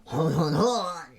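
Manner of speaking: slow, high, caricatured French laugh; hammed-up French. Shown as a demonstration. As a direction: draw out a mock-French chuckle. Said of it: "you can channel your inner Frenchie".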